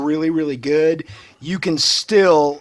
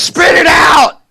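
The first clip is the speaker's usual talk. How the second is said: impatient shout; 12 semitones higher, 8 dB louder, slower than usual. Real, not acted. Impatient yell at stammering friend.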